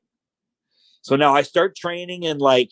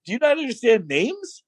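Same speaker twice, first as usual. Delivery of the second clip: exasperated correction; 13 semitones higher than usual. Retold or imitated. Exaggerated replay of correcting the Italian.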